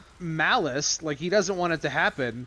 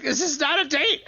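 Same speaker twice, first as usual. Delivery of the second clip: much higher, insistent mock protest; exasperated denial; 11 semitones higher, 4 dB louder than usual. Gambit insists to the girl it's not a date, high and exasperated. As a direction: shut her down, exasperated.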